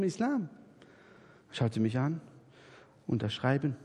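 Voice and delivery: ordinary delivery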